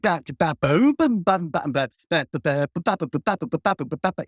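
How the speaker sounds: imitating his bass playing again, fading out; scatted bass groove. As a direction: keep the groove going with "ba ba". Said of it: "I'm like".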